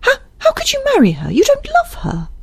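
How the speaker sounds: very high, distressed and incredulous; high-pitched anguished character voice; horrified Isabel. Acted. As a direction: stammer out a rapid, shocked protest.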